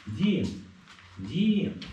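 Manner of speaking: high calling out. Real, not acted. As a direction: call the dog from afar. Calling the lost dog.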